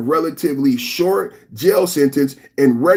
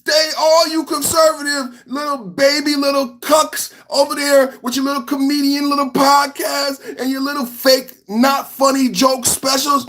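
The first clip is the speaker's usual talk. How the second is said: mocking rant; 10 semitones higher than usual. Real, not acted. Ranting at conservative comedians.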